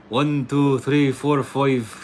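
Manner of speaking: counting to five in a hammed-up Irish lilt; Irish accent. Streamer doing an Irish accent for a viewer from Ireland, hammed-up Irish accent. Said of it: "here's my best Irish impression".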